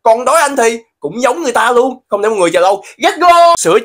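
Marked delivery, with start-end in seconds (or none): 3.0-3.5 high loud catchphrase yell; excited shout. Acted. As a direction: shout it like a show host. Catchphrase launching each challenge.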